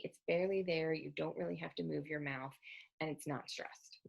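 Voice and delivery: ordinary talk, nothing marked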